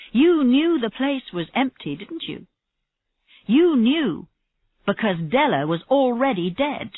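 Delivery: pressing accusation. A narrator's reading. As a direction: corner him with the truth. Detective presses the suspect hard.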